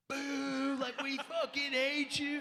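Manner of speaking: high, loud jeering boo, then slow angry hate; booing crowd. Retold or imitated. Fans booing the actor who plays Shane, jeering shout. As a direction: shout him down like a hostile fan. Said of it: "And people were like".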